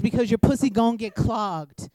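ordinary talk, nothing marked

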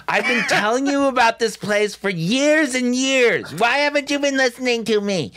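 high, loud nagging parent voice; nagging God voice. Retold or imitated. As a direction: nag like a fed-up mother. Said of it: "God is nagging us at this point".

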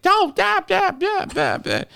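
mocking panicked mom. Mimicking the other driver's wife flipping out, very high squeal. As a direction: squeak nonsense like a frantic woman. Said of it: "baby's mama's flipping out".

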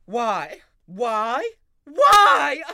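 building to a shout; rising anguished why; anguished cries. Acted. Raymond's despairing outburst.

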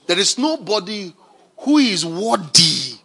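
usual speaking voice

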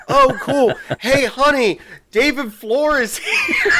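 imitating a thrilled fan, high and laughing; excited fan calling out. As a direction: call out to her husband in a high, laughing voice.

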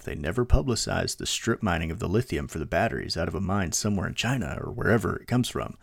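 usual speaking voice

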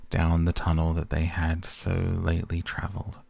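ordinary delivery

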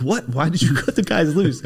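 retold angry sergeant. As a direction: play the first sergeant chewing him out, half-laughing. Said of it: "And he'd be like".

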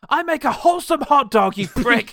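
high, very loud hammed-up outrage; mock screaming fit; retold furious yell. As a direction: yell it like an affronted salesman. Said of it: "so he just yells at him".

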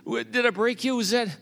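high, worried rush of questions; worried dad after the ride; anxious checking. Retold or imitated. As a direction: check on him frantically. Dad kneels checking dazed son.

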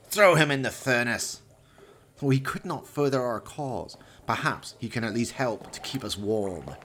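a villain dismissing his dead henchman; Valdemar, cold villain. Acted. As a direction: savour each cruel word. Valdemar voiced in the story.

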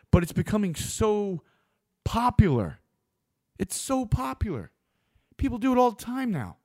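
ordinary talk, nothing marked

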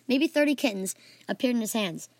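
ordinary delivery, nothing marked

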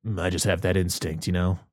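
lightly voiced smug guru; mock mysterious expert. Professor explaining his croc instinct, low and knowing. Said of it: "And he's like".

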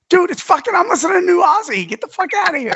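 high, exasperated mock rant. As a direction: brush him off in a high voice. Retold exasperated reply to texts.

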